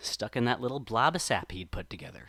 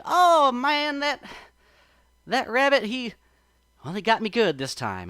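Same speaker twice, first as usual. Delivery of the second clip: high, loud exclamation easing off; rueful admiration; 11 semitones higher, 8 dB louder than usual. A narrator's reading. Brer Fox realizes the rabbit got him, rueful exclamation. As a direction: admit you were fooled, starting loud. Fox slaps knee, admits defeat.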